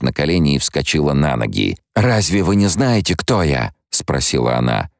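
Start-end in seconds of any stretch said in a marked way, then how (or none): 1.9-3.7 a lightly voiced sweet young girl, higher at the start; sweet young Alia. Alia asking the guards in her old sweet girl's voice. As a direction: sound like the innocent girl she once was. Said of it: "Это был её старый голос, голос той сладостной юной Алии".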